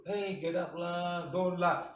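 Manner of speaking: slow, drawn-out coaxing; ineffective wake-up. Shown as a demonstration. Half-hearted attempt to wake someone lazy, slow coaxing. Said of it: "He'll sleep some more".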